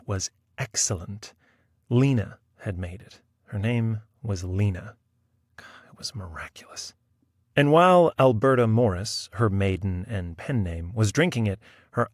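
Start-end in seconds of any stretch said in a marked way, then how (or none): 5.6-6.9 low, whispered aside; hushed awe. A narrator's reading. Narrator remembers Lena's chocolate, awed whisper. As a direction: drop to a reverent whisper.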